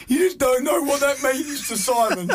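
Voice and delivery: high, choked-up gushing; tearful gushing. Retold or imitated. Telling wife Kerry through tears, high and choked. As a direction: blubber it out, voice up high. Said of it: "I'm going to Kerry".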